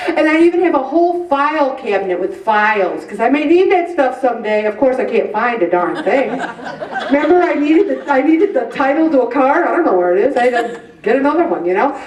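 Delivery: ordinary talk, nothing marked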